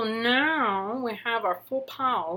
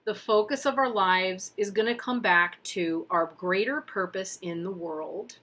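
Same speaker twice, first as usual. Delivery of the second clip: ordinary talk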